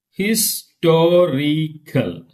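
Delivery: "Historical" very slow, his-to-ri-cal; syllable by syllable. Shown as a demonstration. Teacher counting syllables for class, slow split word. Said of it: "One, two, three".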